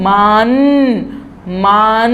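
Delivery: "मान" ending on a clear n; tongue up on the palate for "मान"; mān with full n. Shown as a demonstration. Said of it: "मेरी ज़बान जो है वो ऊपर तर्वों पे लग रही है".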